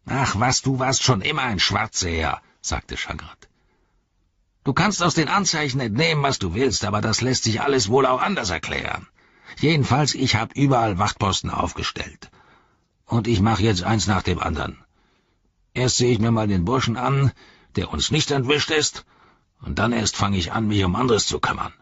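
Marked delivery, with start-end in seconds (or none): 0.1-2.4 higher, scoffing orc captain; dismissive orc. Acted. Voices Shagrat brushing off Gorbak. 4.6-21.7 higher-pitched orc captain arguing back; irritable orc. Acted. Voices Shagrat arguing back.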